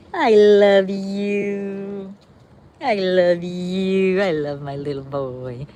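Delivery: slow, drawn-out loving coo; cooing to the dog. Real, not acted. Owner to her old little dog, slow tender coo. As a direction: stretch each word out like petting him.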